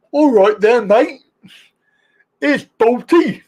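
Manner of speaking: bad Brummy accent. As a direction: do a terrible Birmingham accent. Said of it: "That's the worst Brummy accent you've ever heard".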